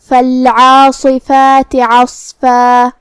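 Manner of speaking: slow, measured tajweed chant with long held vowels; Quranic recitation. Shown as a demonstration. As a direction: recite the verse on a level, drawn-out tone. Recites verse as demonstration.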